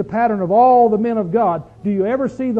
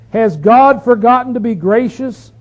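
loud, slow rhetorical question; ringing, drawn-out Bible reading; scripture reading; 3 semitones higher, 7 dB louder than usual. Acted. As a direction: proclaim the psalmist's question to the congregation. Reads psalm's questions aloud.

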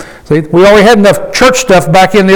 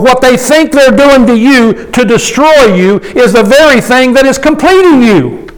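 ordinary talk, nothing marked

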